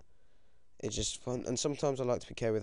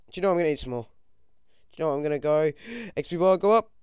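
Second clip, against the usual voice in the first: high, loud and rising urgency; urgent excitement; 6 semitones higher, 10 dB louder, much faster than usual. Real, not acted. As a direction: build to an excited high call. Gamer urging his XP bar upward.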